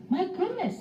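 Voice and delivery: high, amazed mother voice; lightly voiced surprised mother; mother's surprise. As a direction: gasp it out as the astonished mom. Clinton's mother exclaims in story.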